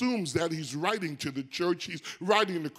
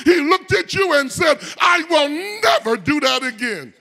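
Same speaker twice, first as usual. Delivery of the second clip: loud, high, driving sermon delivery; full-force preaching; 6 semitones higher, 9 dB louder than usual. Acted. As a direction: shout it to the congregation, building to the punchline.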